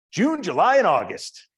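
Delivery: high, loud triumphant punchline; the professor delivering his favourite joke, loud and gleeful. Retold or imitated. Old professor tells a student the best perk, loud and high.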